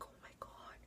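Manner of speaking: breathless whisper, no voice at all; whispered shock. Retold or imitated. Caught in the wrong room, a horrified whisper. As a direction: hush it like you're caught. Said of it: "I'm just like".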